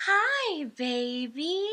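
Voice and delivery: put-on high, calming baby talk, with the baby part whispered; motherese. Shown as a demonstration. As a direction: coo it up high to soothe the infant. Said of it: "you have this real high pitch".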